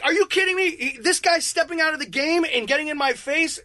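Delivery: high-pitched incredulous outburst; indignant protest. Retold or imitated. Player protesting to the blue, indignant and high.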